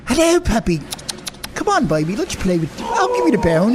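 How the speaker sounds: English accent. Shown as a demonstration. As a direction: fake English accent, cooing to a dog. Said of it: "Do an English accent when you talk to the dogs".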